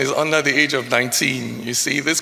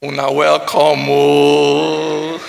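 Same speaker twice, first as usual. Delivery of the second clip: Pidgin welcome; 3 semitones lower, 4 dB louder, much slower than usual. Shown as a demonstration.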